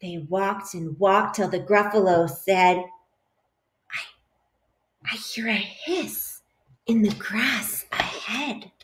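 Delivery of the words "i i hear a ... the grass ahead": soft, slow, uneasy hush; listen hard, barely above a breath; hushed and wary. Acted. Gruffalo warns the mouse of a hiss, softly and slowly.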